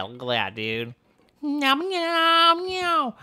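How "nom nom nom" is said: high, drawn-out nom sounds; playful high-pitched chomping; munching noises. Pretend. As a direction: pretend to gobble in a squeaky pitch. Pretending to munch the building.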